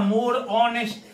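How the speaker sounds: measured teacher's repeat; slow model answer. Shown as a demonstration. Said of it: "इसके पहले more लिखेंगे".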